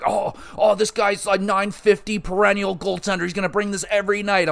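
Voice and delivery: high-pitched put-on voice of gushing fans; mocking hype in a high voice; mock overexcited fans. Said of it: "everyone was like".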